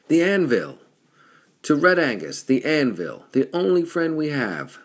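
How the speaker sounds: high, very loud tavern cheer; full-voiced shout to the whole room; crying out, roaring toast. A narrator's reading. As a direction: bellow it out like a rowdy toast. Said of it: "The roar echoed in his ears".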